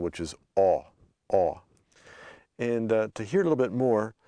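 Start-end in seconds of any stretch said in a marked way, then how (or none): 0.6-1.6 "aw" open and rounded, repeated separately. Shown as a demonstration. Teacher creating the new sound, open "aw". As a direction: open up each "aw". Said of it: "we have to create a new sound".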